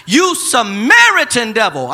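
loud, slow "Samaritan" stretched; slow shouted slur. Acted. Preacher hurling the crowd's slur, loud and slow. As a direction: drag out the insult. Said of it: "The people retorted".

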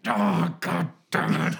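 loud, drawn-out mock-angry roar; high, slow, exaggerated angry growl; grizzled and angry. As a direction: imitate a grizzled fighter roaring in fury. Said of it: "he's so grizzled and angry".

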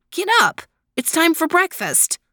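high-pitched, eager rousing voice; wake-up call. A narrator's reading. Desmond rousing his brother for breakfast, high excited call. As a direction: pitch it way up to get someone out of bed.

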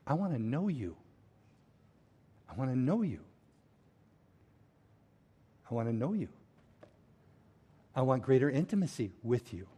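whisper-close, yearning to know God; a hushed heartfelt prayer; tender longing. Acted. Moses' longing voiced softly.

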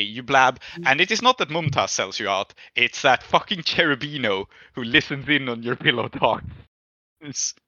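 usual speaking voice

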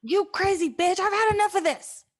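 angry outburst at Anne; furious. Acted. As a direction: lash out at her. Furious retort.

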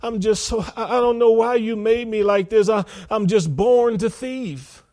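high, loud imitation of whining excuses; hammed-up pleading, no real tears; mock tearful plea. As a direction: protest to God as a put-upon sinner. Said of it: "you can cry and say".

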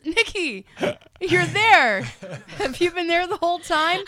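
high and loud, caught off guard and thrilled; excited relief. Retold or imitated. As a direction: blurt it out, suddenly overjoyed. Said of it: "And he goes".

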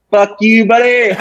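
high, drawn-out jeer, breaking into a laugh; gleeful taunt. Real, not acted. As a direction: stretch it out high as a joking kiss-off. Mock insult at the booker.

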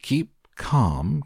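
high, drawn-up exclamation; storyteller's mild cry to an upset parent. A narrator's reading. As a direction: pitch it up without shouting. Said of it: "cried Mister Wonka".

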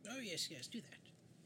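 soft, high, breath-light assent; hushed in-character approval. Acted. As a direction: agree softly in the put-on voice. Character answers companion in roleplay.

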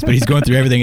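ordinary talk

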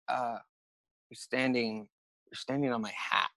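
soft, hesitant mumble; imitating a timid, quiet reply, haltingly; sheepish, timid. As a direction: point it out meekly, afraid to bother him. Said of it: "he's kinda sheepish".

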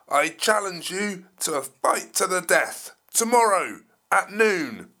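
loud roaring dinosaur voice; angry T-Rex roar. Acted. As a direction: bellow like a furious T-Rex. T-Rex challenges Geoffrey.

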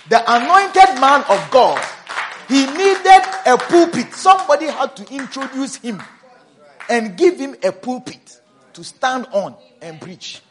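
loud, high preaching cry; full-force preaching. Acted. Pastor preaching to his church, loud and high. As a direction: proclaim it to the congregation at full force.

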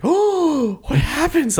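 very high, thrilled gasp; excited gasp. Retold or imitated. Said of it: "I was like, gasps".

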